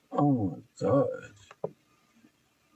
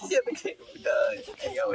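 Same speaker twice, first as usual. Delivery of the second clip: laughing. Real, not acted. Laughing hard at fight clip.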